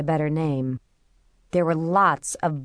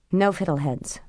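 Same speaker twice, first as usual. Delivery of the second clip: ordinary delivery, nothing marked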